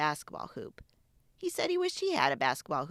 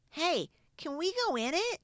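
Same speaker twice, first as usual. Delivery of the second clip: put-on eager boy asking; 10 semitones higher than usual. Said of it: "he asked".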